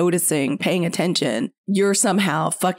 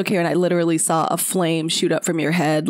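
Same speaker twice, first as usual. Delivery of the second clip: usual speaking voice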